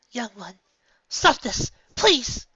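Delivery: begging the filly to stop; Celestia crying out. A narrator's reading. Celestia begs the young filly to stop, rising high plea. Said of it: "Celestia cried out".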